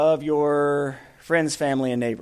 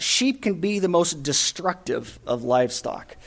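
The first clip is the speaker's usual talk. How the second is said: ordinary talk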